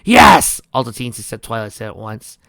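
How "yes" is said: shouted yes. Acted. Teens answer at once.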